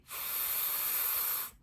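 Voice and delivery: long voiceless "f", lip lightly bitten; stretched-out f. Shown as a demonstration. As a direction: bite the lip and let "f" hiss on. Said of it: "I bite my, my lip a little bit".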